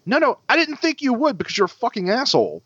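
high-pitched indignant insult; retold angry comeback. Driver insulting the man walking away, angry. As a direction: call after him, fed up.

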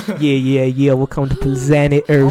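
lightly mock the nature show voiceover. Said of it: "I'm thinking of [name]".

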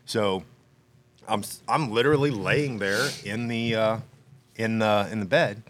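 ordinary talk, nothing marked

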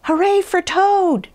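high, drawn-out cheer; high-pitched hooray from a shrinking frog; small Frog's shout. A narrator's reading. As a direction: cheer slowly in a high little voice. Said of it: "Frog looked smaller as he shouted".

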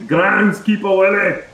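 drawn-out Australian slip; Australian accent. Acted. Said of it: "Four words in".

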